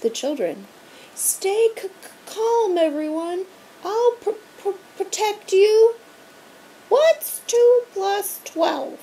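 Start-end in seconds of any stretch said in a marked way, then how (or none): 1.1-6.0 high, stuttering scared reassurance; out-of-breath principal calming kids; stammering fear. A narrator's reading. 6.9-9.0 high, loud sing-song teacher voice; creepy strict teacher calling; teacher calling after fleeing students; monster teacher. A narrator's reading. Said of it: "questioned Miss Irma Birnbaum".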